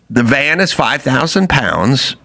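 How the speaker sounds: usual speaking voice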